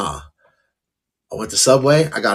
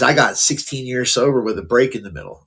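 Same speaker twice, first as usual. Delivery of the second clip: ordinary talk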